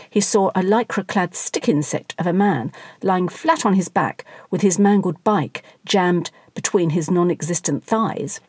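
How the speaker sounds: plain reading voice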